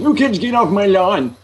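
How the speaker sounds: put-on high, cranky old-person yell; hammed-up elderly crank chasing kids away; mocking old voice. Friend spoofing an old person yelling at kids, mockingly cranky. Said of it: "imitate an old voice without at some point going".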